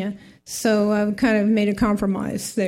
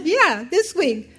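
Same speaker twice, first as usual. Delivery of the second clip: slow, higher reply, falling at the end; amused confirmation; 5 semitones higher, slower than usual. Retold or imitated. Agency caller answering stunned mother, amused confirmation. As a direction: confirm it slowly to someone incredulous.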